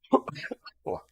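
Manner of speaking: short real cough, then a breathy oh; coughing fit. Real, not acted. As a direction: cough mid-sentence. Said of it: "excuse me, I'm still coughing".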